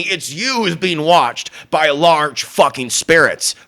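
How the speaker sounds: normal talking voice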